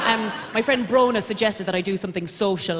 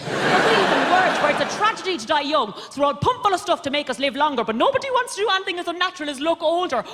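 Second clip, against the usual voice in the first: high-pitched, mounting comic tirade; exasperated rant; 9 semitones higher than usual. Acted. Daughter ranting to mother about ageing, exasperated. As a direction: rant it high and increasingly fast.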